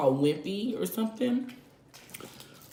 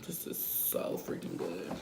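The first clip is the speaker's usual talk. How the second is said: whispering; 12 semitones lower, 12 dB quieter than usual. Real, not acted. Whispered while eating.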